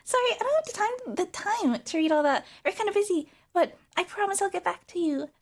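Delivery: high, loud, chirpy brush-off; mock-sweet streamer squeal; dismissive cute streamer. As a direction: brightly blow off the fan in a put-on idol voice. Acts the streamer brushing off the fan.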